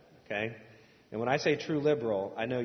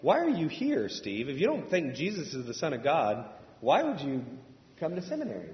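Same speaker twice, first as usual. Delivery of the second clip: higher, louder, baffled asking; puzzled question to Steve; 4 semitones higher, 4 dB louder than usual. Retold or imitated. Preacher asking Steve why he came, baffled and loud. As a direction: demand an answer, bewildered. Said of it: "I'm like".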